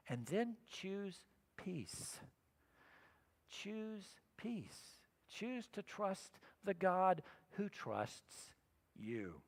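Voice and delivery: slow and soft, easing them into peace; soft slow preacher's close; gentle exhortation. Real, not acted. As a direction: let it settle, quiet and unhurried.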